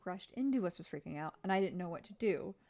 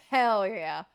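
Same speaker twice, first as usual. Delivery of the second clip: a loud, gleeful yell of pride; loud triumphant hell yeah; proud shout; 13 dB louder than usual. Acted. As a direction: bellow it like a proud wild child. Dad proudly owns up to prank.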